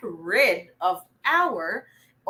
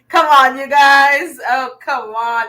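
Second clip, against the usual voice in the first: loud, higher come-on; playful protest; 3 semitones higher, 10 dB louder than usual. Real, not acted. Teacher to joking viewers, loud playful protest. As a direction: call it out loudly in playful protest.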